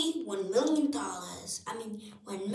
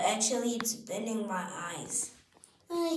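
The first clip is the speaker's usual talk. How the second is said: normal talking voice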